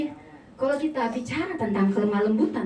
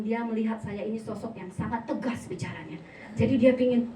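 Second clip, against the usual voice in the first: ordinary delivery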